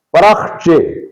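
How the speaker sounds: پرخچے as "parakh-che"; short a after the r in پرخچے, none on the kh; درست تلفظ. Shown as a demonstration. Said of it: "رے پر بھی زبر ہے". (پرخچے، رے پر زبر، خے پر جزم)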